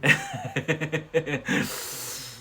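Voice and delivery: long chuckle at own joke; real laugh. Real, not acted. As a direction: laugh it out in a quick run of beats. Laughs after the clipping joke.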